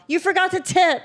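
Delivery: high, sing-out call after someone; mock yell. Customer calling after non-tippers in a coffee shop, mock yell. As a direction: call it out high, shaming them in public. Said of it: "great opportunity for you to yell".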